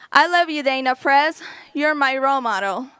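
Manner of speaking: normal talking voice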